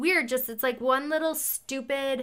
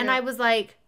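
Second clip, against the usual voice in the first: usual speaking voice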